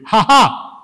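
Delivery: a loud, put-on "ha ha" on cue; the whole church obeying a forced laugh; forced congregation laugh. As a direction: fake a big laugh because you're told to. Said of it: "everybody had to repeat".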